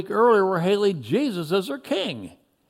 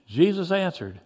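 ordinary delivery, nothing marked

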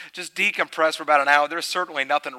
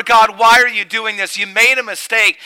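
mock cry to God; 7 semitones higher, 11 dB louder than usual. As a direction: shout up at heaven. Voiced accusation to God.

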